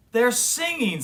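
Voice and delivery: high, excited, amazed. A narrator's reading. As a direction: marvel at the discovery. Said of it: "said the cubs, amazed".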